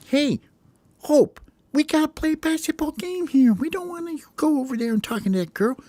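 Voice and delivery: very high, squeaky little bird voice; tiny bird calling his buddy back; squeaky bird teammate. Acted.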